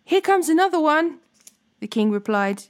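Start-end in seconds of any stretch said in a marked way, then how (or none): 0.0-1.2 high, projected lookout's call; shouted announcement. A narrator's reading. Watchman calls news down to the king, shouting. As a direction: call it out, restrained in volume. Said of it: "He shouted".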